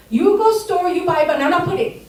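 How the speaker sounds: mock the mother's broken English, up high; imitating mom's accent, high and loud. Mom repeats the order.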